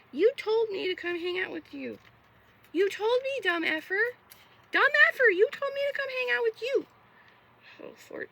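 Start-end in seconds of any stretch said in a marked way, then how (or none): 0.1-6.8 rising to a high, loud outburst; ranting. Acted. Vents at friends who flaked, building to a shriek. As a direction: yell it at the friends who bailed.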